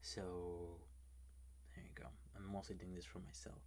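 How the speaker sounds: hushed whisper for ASMR. Real, not acted. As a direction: whisper so the roommate sleeps. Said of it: "I'm whispering because".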